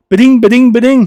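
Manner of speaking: high, loud mimicked ringtone; rapid high-pitched ding noises from the mouth; imitated notification dings. Mimicking alerts that interrupt his game, high and insistent.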